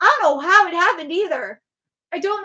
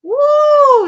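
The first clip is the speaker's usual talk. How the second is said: high, loud ooh of discovery; sudden realization; 12 semitones higher, 11 dB louder than usual. Real, not acted. To chat, finding the stream problem, surprised ooh. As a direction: it just clicked.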